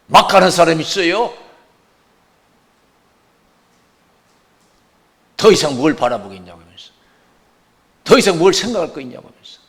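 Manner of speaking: loud fervent preaching cry; shouting preacher. Real, not acted. (큰 소리로 외치는 설교)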